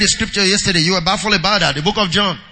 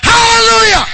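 very high, loud hallelujah; ringing preacher's shout; exultant shout; 13 semitones higher, 7 dB louder than usual. Real, not acted. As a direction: cry it out at the top of the voice. Rallying cry to congregation.

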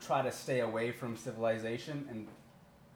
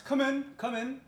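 high, welcoming official voice; Tobias voice; 6 dB louder than usual. Acted. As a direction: call them in, high and bright. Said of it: "you hear Tobias".

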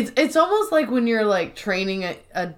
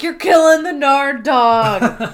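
loud, higher, drawn-out wail; mock protesting yell; 4 semitones higher, 6 dB louder, slower than usual. Andy to Kevin over the botched transfers, mock wail. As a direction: howl it in mock agony.

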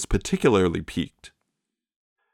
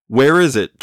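loud, higher shout; 5 semitones higher, 6 dB louder than usual. A narrator's reading. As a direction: yell the question at her. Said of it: "shouted the Gunsel".

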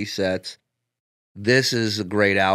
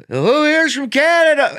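high, loud emcee question; onstage crowd work; 7 dB louder than usual. Retold or imitated. Comic redoing his opener to a Toronto crowd. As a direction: call it out to the theater.

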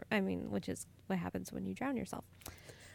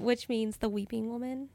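usual speaking voice

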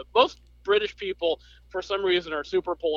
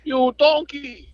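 drawn-out, loud, higher mock insult; Gordon Ramsay impression; 9 semitones higher, 7 dB louder, slower than usual. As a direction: yell it slowly like a furious celebrity chef. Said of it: "Gordon Ramsay".

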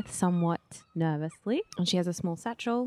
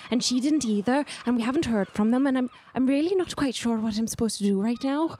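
put-on higher, nervously rambling young traveler; anxious young bird-woman; 6 semitones higher, faster than usual. Agnar explains she is meeting someone unknown, nervous character voice.

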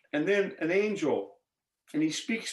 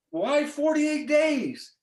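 high, drawn-out incredulous question; mock wail of disbelief; acted dismay; 10 semitones higher, much slower than usual. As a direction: stretch the protest out at a very high pitch. Acted dismay at fast's length.

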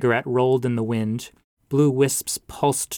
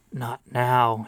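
usual speaking voice

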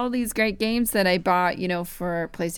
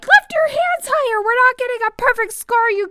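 much higher, louder mock yelling; yelling at a friend; 5 dB louder than usual. Twirling a friend in the dance game, high playful yelling. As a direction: bark orders like a frantic dance coach. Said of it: "yelling at them".

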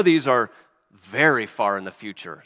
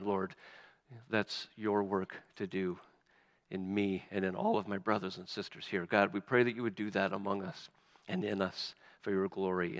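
soft, hushed prayer that keeps fading softer; 3 semitones lower, 3 dB quieter than usual. Real, not acted. Pastor praying for the congregation's hearts, hushed prayer. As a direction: pray quietly and humbly, trailing away. Pastor prays after the reading.

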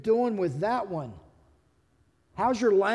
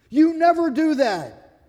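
loud, higher, slow accusation; accusing; 6 semitones higher, 6 dB louder, slower than usual. Acted. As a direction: throw it at a spouse as a complaint. Acted accusation in couple's argument.

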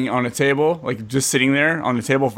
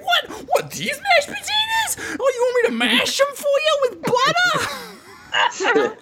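very high, drawn-out squawking mock outrage; Ray impression; slower than usual. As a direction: shriek it slowly in a put-on falsetto, close to laughing. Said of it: "That was a good Ray".

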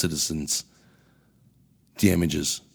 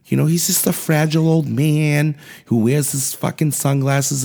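high, whiny mock voice of a fussing reporter; mocking the media; 9 semitones higher, 4 dB louder than usual. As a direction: pitch it up and whine like a pearl-clutching journalist. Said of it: "and the media says".

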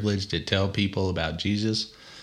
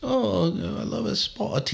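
slow, high-pitched British parody; mock British accent; 12 semitones higher, slower than usual. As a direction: draw it out in hammed-up British. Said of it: "We should just speak in British accent".

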